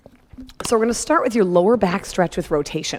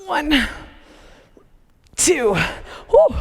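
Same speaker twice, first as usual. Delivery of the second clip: higher, breathless count; straining while rowing; 8 semitones higher, much slower than usual. Real, not acted. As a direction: puff out the count and exhale. Finishing hard rowing set.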